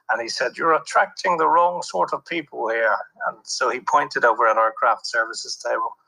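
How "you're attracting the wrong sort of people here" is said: imitating a posh local. Local man keeps complaining.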